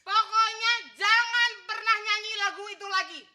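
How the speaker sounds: loud, scolding ultimatum; marah banget. Acted. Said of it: "Sampai Po marah banget". (marah besar, melarang dengan keras)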